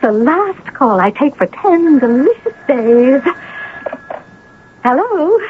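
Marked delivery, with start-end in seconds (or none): 4.8-5.4 high, chirpy hello; bright phone greeting. Acted. Novelist answering Kathy's call, high and chirpy.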